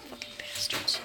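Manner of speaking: whispered, under-the-breath swearing; a hushed angry aside; muttered curse. Real, not acted. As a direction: curse quietly to yourself.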